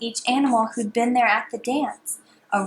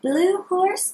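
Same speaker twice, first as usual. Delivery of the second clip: higher, bright calling tone; sing-song call; 7 semitones higher than usual. Shown as a demonstration. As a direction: call out the name. Repeat call.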